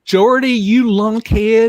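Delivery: high, slow mock yokel bark; impression of Stephen King. Imitating Stephen King's line to Jordy from Creepshow. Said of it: "he says".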